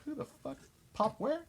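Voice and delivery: high-pitched, incredulous mock confusion; acted bafflement. Imitating his bandmate's reaction to British slang, baffled. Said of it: "You're gonna be like".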